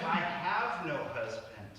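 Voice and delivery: "I have no husband" slowly, weight on "have"; slow knowing tone. Shown as a demonstration. Preacher retelling the woman's reply, slow and pointed. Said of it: "it's all about tone".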